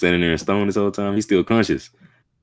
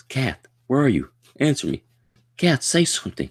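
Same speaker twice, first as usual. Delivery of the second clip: hushed, higher call for the cat; a worried searching call, pitched up; calling out anxiously; 9 semitones higher than usual. Acted. Arthur calling lost cat.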